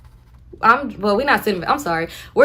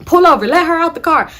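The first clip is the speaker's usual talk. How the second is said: shouted order; 9 semitones higher, 8 dB louder than usual. Retold or imitated. Nephew yells at driver.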